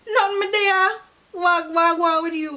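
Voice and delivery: high, lively laid-back answer; high patois reply. Shown as a demonstration. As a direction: toss the greeting back up high. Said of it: "I would be like".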